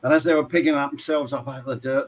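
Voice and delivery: ordinary talk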